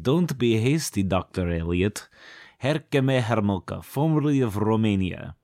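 hammed-up Romanian accent; Herkimer Homolka. Romanian stepping in to introduce himself, mock Romanian accent. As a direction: put on a stagey Romanian accent. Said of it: "oh, this accent".

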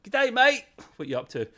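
high, loud Aussie greeting; thick Aussie accent. Acted. Wishing the character were an Aussie, thick Australian accent. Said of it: "a really thick Aussie accent".

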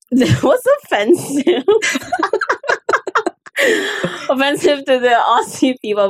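helpless giggling protest; laughing through words. Real, not acted. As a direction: talk while cracking up. Laughing at Mark's accent clip.

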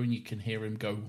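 normal talking voice

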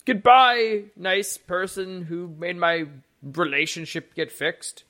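excitable character voice easing down slowly; grateful stable man. Acted. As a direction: draw out a fond, hammed-up farewell. Stable man's grateful farewell in game.

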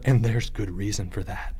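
low, soft ominous remark; dark. A narrator's reading. Leo hinting at danger in the woods, darkly. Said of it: "Leo said darkly".

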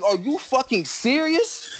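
can't believe the cop is serious; incredulous. Retold or imitated. Answering the cop who ticketed him, incredulous high outburst.

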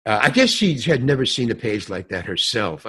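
ordinary delivery

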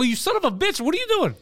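high-pitched angry outburst; hammed-up pissed-off confrontation; retold outrage. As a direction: tell him off in a high, exasperated voice. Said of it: "I was so pissed".

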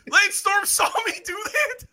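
high, loud, laughing disbelief; shocked laughing shout. Real, not acted. Host learns a wrestler saw his mistake, laughing shout. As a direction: blurt it out, stunned and laughing. Host hears about embarrassing tweet.

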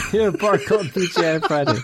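words breaking into laughter; laughing. Real, not acted. Giving in to co-host's silly bar name, laughing. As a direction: say it while giggling.

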